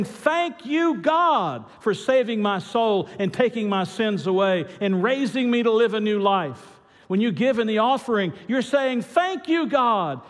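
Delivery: high, forceful proclamation of thanks; fervent preaching. Real, not acted. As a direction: lift the voice in exultant gratitude.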